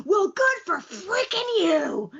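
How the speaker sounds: high, drawn-out bitter mock yell; acted-out sarcastic cry, stretching the words; Twice's tearful outburst. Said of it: "crying his ma".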